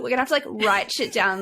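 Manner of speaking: ordinary delivery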